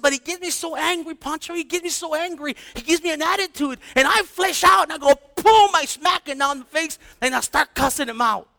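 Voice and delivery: high-pitched mock rant building to a loud outburst; exasperated, frustrated. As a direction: imitate a fed-up parent venting, pitch jumping high. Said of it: "that's exasperation and frustration".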